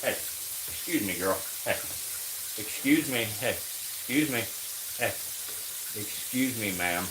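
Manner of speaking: softly calling to the snake as if to a lady; mock polite. Real, not acted.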